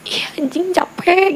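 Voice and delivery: a weary cry, voice jumping up on capek; exhausted outburst. Retold or imitated. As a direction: blurt out how tired you are, pitch shooting up. (lelah meledak, suara naik tajam di "capek")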